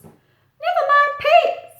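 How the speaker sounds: high, loud chirpy bird voice; little bird voice. Acted. Little bird greeting Pete, high and loud. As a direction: squeak it out like a tiny bird. Steve says goodbye.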